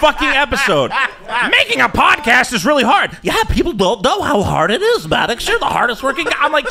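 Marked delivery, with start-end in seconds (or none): 1.5-3.2 higher, self-pitying mock voice; hammed-up complaining impression; mock Maddox. Host mimics the podcaster grumbling about his work, mock whiny. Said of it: "it's just Maddox going". 3.2-6.3 put-on fawning sidekick voice; hammed-up goofy flattery; mock Asterios. Host mimics the sidekick sucking up to the podcaster, mock fawning. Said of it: "And Asterios goes".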